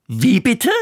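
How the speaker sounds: high indignant outburst; the king cries out in disbelief; shocked outraged cry. A narrator's reading. King shouting at his youngest daughter, outraged. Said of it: "schrie der König".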